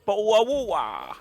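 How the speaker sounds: high mock-British 'bottle of water'; exaggerated English accent with glottal stops; bad English accent. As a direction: put on a silly, high-pitched British accent. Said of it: "a shit English accent".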